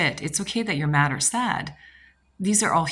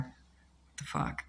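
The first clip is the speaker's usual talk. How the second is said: mock dumbfounded; 14 dB quieter, much slower than usual. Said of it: "standing there going".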